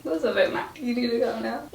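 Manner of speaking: hushed note to yourself; soft self-reproach. Pretend. Speaker calming herself after embarrassment, softly. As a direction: quietly talk yourself down.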